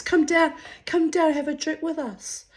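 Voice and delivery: very high, loud mock invitation; imitating a pub caller showing off for his mates. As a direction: ham up a tipsy bloke coaxing. Ex voiced inviting.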